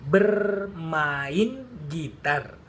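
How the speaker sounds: "bermain gitar" spoken slowly, syllable by syllable, ber-ma-in gi-tar; slow syllable model. Shown as a demonstration. Teacher showing students the syllables of "bermain gitar", slow and clear. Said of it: "So if I underline".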